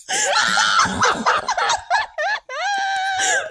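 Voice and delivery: long, high-pitched shrieking laugh. Real, not acted. Friend cracking up at the girl power joke, shrieking laughter. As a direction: burst out cackling, very high.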